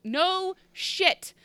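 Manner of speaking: high, incredulous scoff. Real, not acted. Mocking the doctor's line.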